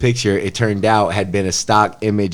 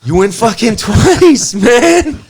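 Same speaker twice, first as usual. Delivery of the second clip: high, loud, slowed and laughing; giddy shout about winning twice; 13 semitones higher, 5 dB louder than usual. Real, not acted. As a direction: howl it out through a laugh. Laughing, over-the-top excitement.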